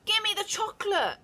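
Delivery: put-on loud, high boy shout. A narrator's reading. Bruno suspicious, shouting at the witch. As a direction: yell it like a scared brat. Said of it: "shouted Bruno".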